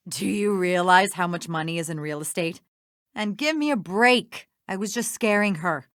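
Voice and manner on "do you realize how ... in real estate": low, dismissive scoff; scornful. Acted. Accused schemer sneers at her accuser over motive, scornful. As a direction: laugh first, then low, scornful question.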